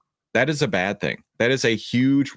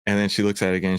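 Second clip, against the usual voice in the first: ordinary delivery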